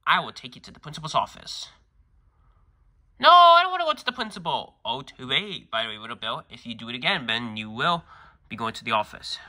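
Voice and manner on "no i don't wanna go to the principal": put-on high, loud whining child's voice; a kid begging not to go; whiny kid protest. Kid protests being sent to principal.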